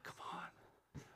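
dad's hushed whisper; imitating a father whispering encouragement; whispered urging. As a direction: push him along under your breath. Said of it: "And my dad's like".

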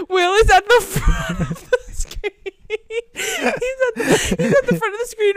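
high, slow words through hard laughter; mother cracking up while telling the story; laughing. Real, not acted.

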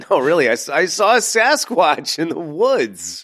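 high, insistent tall-tale claim; pleading like a kid who saw Bigfoot; mock kid voice. Mocking kid claiming Sasquatch.

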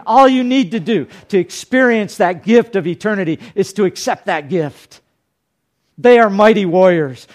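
high, loud altar-call preaching; full-force preaching. Acted.

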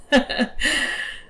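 high, voiceless laugh; short high chuckle; character's laugh. A narrator's reading. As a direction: laugh lightly in her voice. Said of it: "She laughed".

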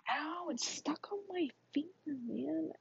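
high, slow, soft complaint; real pained whine. Real, not acted. Girl struggling with a ring stuck on her finger, high whine. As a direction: whimper it as the ring won't budge.

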